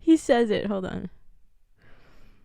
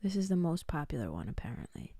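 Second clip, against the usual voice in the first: whispering; 8 semitones lower, 14 dB quieter, faster than usual. Real, not acted. Quietly reads shop page aside.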